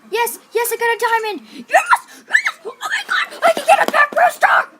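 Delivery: shrieking with joy, very high and loud; excited screaming. Real, not acted. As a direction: scream with delight at the win. Screaming over winning a diamond.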